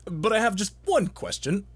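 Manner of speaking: ordinary talk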